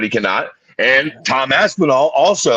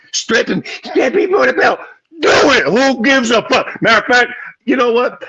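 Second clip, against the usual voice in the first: heated rant; 7 semitones higher than usual. Real, not acted. Podcaster ranting at petition signers, heated shout. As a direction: yell it at the crybabies.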